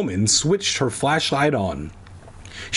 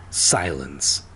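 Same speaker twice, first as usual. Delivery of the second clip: usual speaking voice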